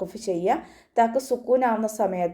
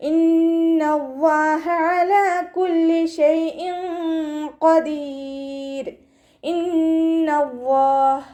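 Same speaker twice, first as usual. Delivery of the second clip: slow, drawn-out melodic tajweed chant; Quran recitation; 8 semitones higher, 4 dB louder, much slower than usual. Shown as a demonstration. Teacher models melodic Quran recitation for students. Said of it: "നൂനിന് ശ്രദ്ധ വന്നു".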